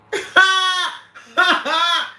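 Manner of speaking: loud, high belly laugh; long high burst of laughter. Real, not acted. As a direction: crack up loudly. Cracks up at the chat.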